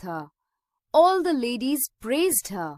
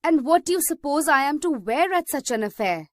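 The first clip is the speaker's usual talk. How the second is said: sharp, louder and faster; indignant, quick retort; furious; 5 semitones higher, 5 dB louder, faster than usual. A narrator's reading. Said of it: "out of furious eyes".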